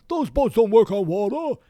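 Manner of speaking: high, loud cartoonish goon voice; henchman's taunt. Film fan imitates a gang member jeering at Marty, loud and high. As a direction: yell it in a silly high put-on voice. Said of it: "one guy's like".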